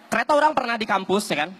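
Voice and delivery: ordinary delivery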